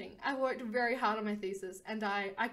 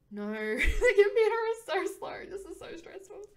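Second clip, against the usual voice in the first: exasperated laugh; 8 semitones higher, 6 dB louder than usual. Acted. Printer too slow before deadline.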